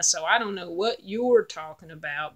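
ordinary delivery

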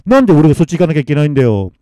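loud, quick objection; desperate protest. Acted. Masamitsu refusing to join the dead, desperate. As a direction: fire it out in alarm. Man protests going to afterlife.

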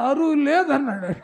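jolted awake, blurting it out loud; startled loud answer. Retold or imitated. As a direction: blurt it loudly, half asleep. Startled believer wakes and answers.

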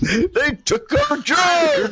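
high, slow, shouted South Park line; mock shout. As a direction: bellow it out slowly, hamming it up. South Park alien quote shouted.

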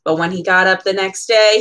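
ordinary delivery, nothing marked

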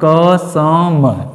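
"कसम" pulled apart into long, separate syllables; drawn-out mnemonic. Shown as a demonstration. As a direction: stretch "कसम" slowly, sound by sound. Draws out mnemonic word for students.

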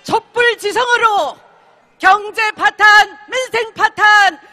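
rally chant, shouted high; roaring rallying speech. Real, not acted. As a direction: lead the crowd in a fiery chant. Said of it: "우리 한번 신나게 외쳐봅시다".

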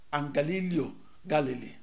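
normal talking voice

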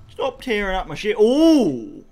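normal talking voice

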